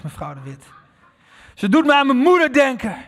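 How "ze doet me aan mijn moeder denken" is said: put-on much higher, louder scornful old lady; elderly woman scoffing at her reflection; indignant old woman. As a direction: sniff at the arrogant stranger. Old woman voiced in story.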